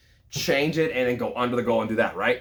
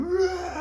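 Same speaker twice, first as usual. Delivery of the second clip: victory roar. Retold or imitated. Celebrating the win alone.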